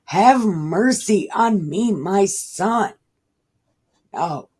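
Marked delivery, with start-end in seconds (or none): 0.1-2.9 loud, higher begging cry; a father crying out to Jesus; loud plea. Acted. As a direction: beg for mercy out loud. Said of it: "He said".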